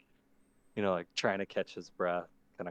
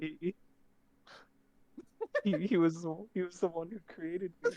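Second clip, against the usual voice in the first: put-on much higher, panicked young creature; frightened kid voice; 10 semitones higher, 5 dB louder than usual. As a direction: stammer in terror. Mister B voice.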